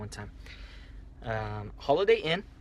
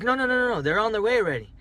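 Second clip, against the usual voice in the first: high, louder rush of protest; urgent reassurance; 8 semitones higher, 5 dB louder, faster than usual. Retold or imitated. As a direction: imitate a dispatcher hurriedly heading someone off. Dispatcher urgently stopping him going in.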